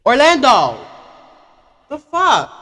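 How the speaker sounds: loud high call, then a sharp shout; shouting across the room. Real, not acted. Someone in the room shouting for Orlando. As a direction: yell his name over the noise.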